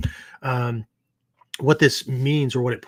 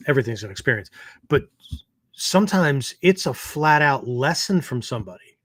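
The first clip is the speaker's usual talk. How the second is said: normal talking voice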